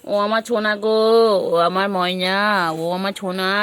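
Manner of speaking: loud sing-song calling for the pet. Real, not acted. (পোষ্যকে জোরে ডাকছে)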